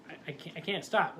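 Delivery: ordinary talk, nothing marked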